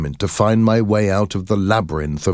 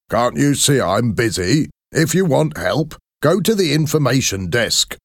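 a touch of a brusque official, higher; impatient inspector; 6 semitones higher than usual. A narrator's reading. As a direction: brush him off without looking up. Busy ticket inspector brushes off.

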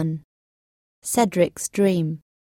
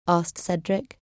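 plain reading voice

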